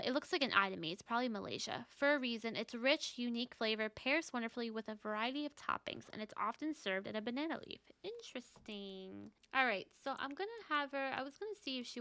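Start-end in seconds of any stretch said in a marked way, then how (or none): none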